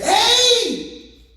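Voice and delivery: waking someone who is dozing, with a loud shout; calling out. Acted. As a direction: yell to jolt a sleeper awake. Waking a sleeping person.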